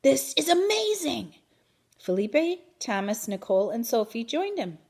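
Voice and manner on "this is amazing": excited. A narrator's reading. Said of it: "he shouted".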